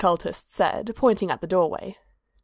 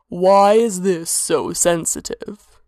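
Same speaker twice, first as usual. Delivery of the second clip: slow, wondering god voice; musing; 4 dB louder, slower than usual. A narrator's reading. Hermaeus muses over the man, slow. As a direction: muse aloud, puzzled. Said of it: "Hermaeus mused".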